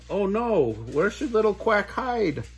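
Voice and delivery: worried Mama Duck. A narrator's reading. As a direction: fret out loud like a fussing mother duck.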